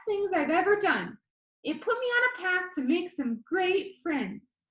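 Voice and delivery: high, bright-voiced video host; upbeat YouTuber. A narrator's reading. YouTuber Danny asks the viewer, upbeat and high. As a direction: pitch it up like an eager vlogger.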